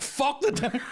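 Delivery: high, scornful exclamation; mock outrage. Mocking girlfriend's dinner.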